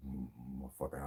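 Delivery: low, very soft grumble to himself; retold muttered curse. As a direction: mutter it under your breath. Retold muttered reaction under his breath.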